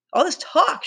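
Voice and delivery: high-pitched exasperated cry; shouted. A narrator's reading. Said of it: "shouted Ralph".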